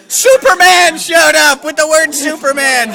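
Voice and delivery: loud laughing shout; a comic shout through laughter; shouted announcement. Acted. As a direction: belt it out like a cameo fanfare.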